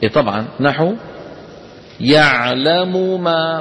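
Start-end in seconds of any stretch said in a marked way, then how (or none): 2.0-3.6 يعلم ما slow, both م kept apart; slow unmerged recitation. Shown as a demonstration. Teacher showing no idgham case. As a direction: say يعلم ما slowly without merging. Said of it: "ما في إدغام".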